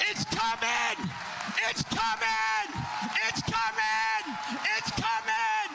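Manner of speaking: very high, drawn-out revival cries; exultant shouting. Real, not acted. Preacher rousing the cheering congregation, exultant shouting. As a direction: scream it over a roaring crowd.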